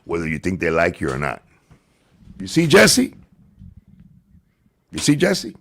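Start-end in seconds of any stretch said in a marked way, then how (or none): 2.4-5.6 taunting. Real, not acted. Taunting a rival streamer.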